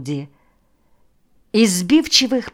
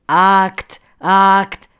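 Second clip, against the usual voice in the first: louder, jeering repeat of one word; mocking mimicry; 3 semitones higher, 7 dB louder than usual. A narrator's reading. Chairman apes the official's talk of an act, mocking. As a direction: parrot his word back in scorn. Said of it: "передразнивал он его".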